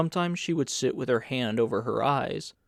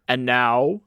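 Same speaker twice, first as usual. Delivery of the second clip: louder commanding call; cried; 7 dB louder than usual. A narrator's reading. Senator calling for attention before questioning Carl, louder. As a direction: claim the room's attention. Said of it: "cried the senator".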